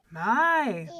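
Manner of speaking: loud, drawn-out wonder; amazed giant. A narrator's reading. Giant seeing the huge baby, amazed. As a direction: boom it out in astonishment.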